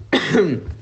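real cough mid-sentence; a short chesty cough. Real, not acted. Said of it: "scusate, ormai la tosse è una costante".

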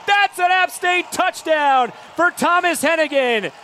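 touchdown call. Real, not acted. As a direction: yell the touchdown as it happens. Long touchdown pass, radio call.

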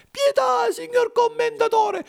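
acutissima e disperata; supplica piangente. A narrator's reading. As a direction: implora piangendo a voce alta. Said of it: "chiangendo a cieli pierti". (Pinucciu implora il burattinaio piangendo, acutissimo)

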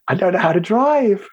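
lightly voiced panicky lad. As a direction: imitate a lad protesting helplessly. Retold boy panicking about driving.

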